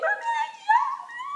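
high, loud wailing cry; mock wail. Imitating a caned girl's wail to her mother. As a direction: wail it like a girl being caned. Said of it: "She's shouting".

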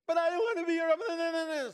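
loud, high-pitched imitated wail; mock crying child. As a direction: wail it like a child throwing a tantrum. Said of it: "she starts crying".

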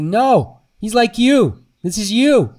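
loud, high, forceful confrontation; retold teacher's outburst. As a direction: imitate an acting coach yelling hard truths at a student. Acts out teacher confronting him.

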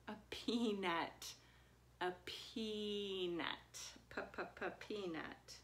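peanut slow, then P-p-p-peanut; letter sound demo. Shown as a demonstration. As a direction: tap out the P in peanut. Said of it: "P says P".

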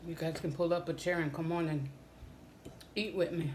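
speaking between bites; talking while chewing. Real, not acted. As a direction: mouth partly full of food. Seen 1: Eating meal on camera, bites just before, mouth working while speaking.

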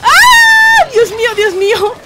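very high loud shriek; shrieking Dios mío; panicked scream. Real, not acted. Sudden scare in game.

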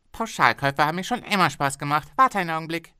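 a pushy boss bargaining hard; haggling factory owner. Acted. Owner cheerfully closing deal.

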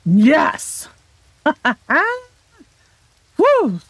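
real triumphant yell with a laugh, ending in a high whoop; loud cheer. Real, not acted. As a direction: cheer loudly at a long-awaited catch. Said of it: "Sorry if that was really loud".

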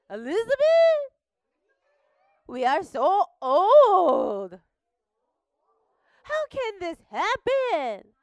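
high, loud disbelieving cries; shocked old man. Acted. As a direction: squeak out your disbelief. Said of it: "Zechariah said".

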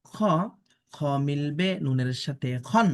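ordinary talk

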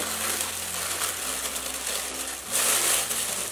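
long, quiet breathy gasp; awed gasp. Real, not acted. Vlogger peeking into the shoe box, awed gasp. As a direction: breathe in slowly, overwhelmed. First look inside the box.

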